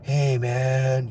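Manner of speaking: spaced-out hey man in a put-on voice; a mock partier's greeting; imitating a coked-up guy. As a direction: drift into it like a dazed partier. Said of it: "Cocaine makes you all like".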